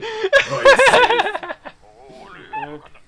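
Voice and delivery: big shrieking laugh; loud high laugh. Real, not acted. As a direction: burst out laughing hard.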